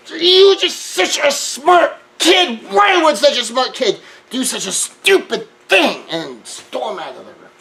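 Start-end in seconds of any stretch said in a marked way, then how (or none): 0.1-6.1 high, loud and slow but kind; heated mother who still builds her son up; intense mother. Retold or imitated. Mother upset with her son yet praising him, high and loud. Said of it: "even in her emotional intensity".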